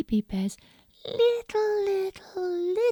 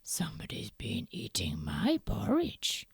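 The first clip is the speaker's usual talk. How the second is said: low whispered gruff bear; deep hushed papa bear; Daddy Bear; 11 semitones lower, 5 dB quieter than usual. Acted. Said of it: "said Daddy Bear".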